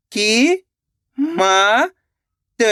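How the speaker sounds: isolated, held sounds with long pauses; teacher slowly spelling out by sound; syllable by syllable. Shown as a demonstration. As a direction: sound out each syllable separately for learners. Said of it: "एक अक्षर का उच्चारण करके".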